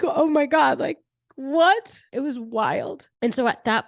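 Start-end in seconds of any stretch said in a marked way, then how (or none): 0.0-2.0 higher, louder, laughing oh my God; amazed disbelief. Retold or imitated. Retelling dad's loving call, higher laughing amazement. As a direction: gasp it out in happy shock.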